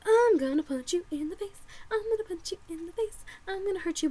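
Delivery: high, squeezed, hushed mock fury; mock threat. Girl to seat thief, suppressed high mock threat. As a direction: hold back the rage, voice climbing.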